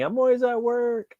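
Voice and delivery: high, slow mock catchphrase voice; his own running joke, said high and drawn out. Shown as a demonstration. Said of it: "That's, like, my, like, thing".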